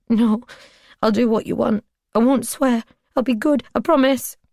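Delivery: terrified captive begging; pleading. Acted. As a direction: beg him desperately.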